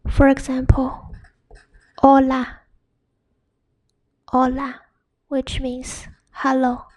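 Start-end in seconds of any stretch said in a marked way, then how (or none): 2.0-4.8 "hola" as OH-la, no H sound; silent H. Shown as a demonstration. Teacher giving example word for learners, silent H. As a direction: start "hola" straight on the vowel. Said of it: "it's silent".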